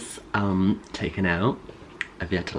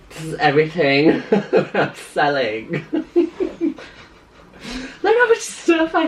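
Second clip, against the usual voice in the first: laughing; 8 semitones higher, 3 dB louder than usual. Real, not acted. Showing mom, laughing.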